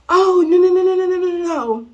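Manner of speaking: high, loud string of panicked no's; freaking-out teacher. Retold or imitated. Teacher hushing a crying student, imitated high panic. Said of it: "like, freaking out".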